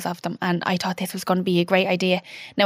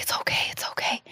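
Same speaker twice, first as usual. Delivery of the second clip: soft unvoiced whisper, soothing; whispered comfort; 8 dB quieter than usual. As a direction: hush her gently, almost no voice. Husband comforting her in shock.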